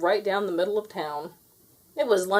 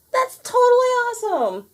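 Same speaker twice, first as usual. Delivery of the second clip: put-on very high, loud boy's shout; excited shout; 5 dB louder than usual. A narrator's reading. Edison shouting at the window, high excited boy. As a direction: shout it like a thrilled kid. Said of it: "Edison shouted".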